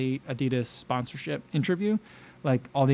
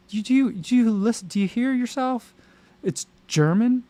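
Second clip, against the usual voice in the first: higher, incredulous, a bit mocking; exasperated disbelief; 9 semitones higher, 4 dB louder than usual. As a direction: ask like they can't be serious. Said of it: "And I'm like".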